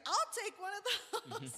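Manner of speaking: high, slow, laughing through it; laughing acceptance. Retold or imitated. Singer happily accepting the drink, laughing. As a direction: let a laugh carry the reply. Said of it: "And she's like".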